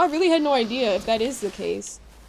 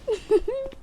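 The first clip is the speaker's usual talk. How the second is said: amused giggle; 13 semitones higher than usual. Real, not acted. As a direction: giggle lightly to yourself. Amused after Midwestern gamers remark.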